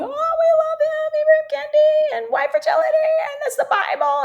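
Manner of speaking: a mocking falsetto of adoring fans.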